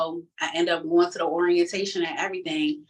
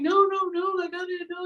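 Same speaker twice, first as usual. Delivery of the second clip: high, frantic protest; going off. Retold or imitated. She protests to her dad, high and frantic. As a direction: plead in a frightened rush. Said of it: "I'm going off".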